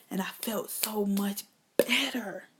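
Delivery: slow, soft whisper. Real, not acted. Telling the crowd about her relief, whispered. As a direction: sigh it out as a hush of relief.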